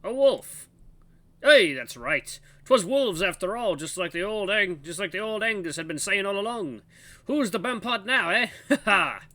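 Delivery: put-on high, loud, triumphant old codger; old guard, crowing. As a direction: gloat like an old man proved right, ending in a laugh.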